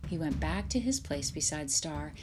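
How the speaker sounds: ordinary delivery, nothing marked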